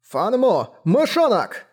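put-on shrill high shout calling the boy; angry yell. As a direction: yell his nickname across the village. Angry villagers yelling at boy. (гневный крик)

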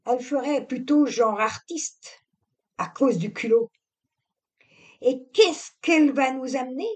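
high, catty servant voice; catty gossiping maid. A narrator's reading. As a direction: sneer at the newcomer, quick and high. Voices Juliette mocking the bride. (servante médisante, voix aiguë)

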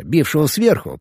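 ordinary delivery, nothing marked

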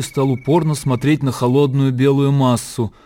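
ordinary delivery, nothing marked

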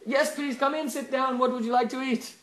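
loud, high, overdone hospitality; eager welcome. Acted. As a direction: usher in an honored guest with gushing eagerness. Wife suddenly welcomes the beggar.

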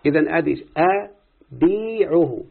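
أبيعه drawn out slowly with a falling end; slow demo. Shown as a demonstration. Teacher slowly reading أبيعه to show how it is written. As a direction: stretch out أبيعه to show the kasra. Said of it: "مش مكتوبة كده".

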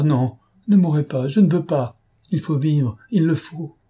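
high, loud, urgent begging; desperate plea. A narrator's reading. Dolores begs him to live.

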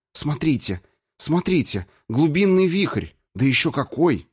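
high, excited exclamations; взволнованный возглас. Acted. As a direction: call out in amazement at the discovery. Открытие вызвало возгласы изумления. (взволнованно, с изумлением)

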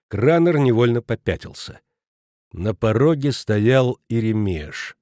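ordinary delivery, nothing marked

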